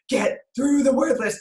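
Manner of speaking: drawn-out, emphatic retold plea; slow, strained insistence. Mother pushing son through homework, slow insistence. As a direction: stretch out each word insistently.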